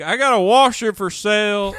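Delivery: high, loud goofy caller voice; prank caller voice. Retold or imitated. Radio prank call, caller hawking a washer in a loud silly voice. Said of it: "you're like".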